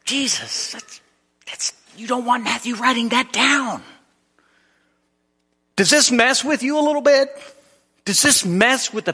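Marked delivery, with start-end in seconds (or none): 0.1-3.8 high-pitched cringing mother; Mary. Acted. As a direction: pipe up, embarrassed on his behalf. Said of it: "If Mary had been next to Jesus".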